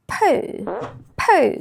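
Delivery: English word "poo", drawn out and falling; English pronunciation. Shown as a demonstration. Teacher showing Thai learners the clashing word, English pronunciation. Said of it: "มันสิไปพ้องกับคำว่า".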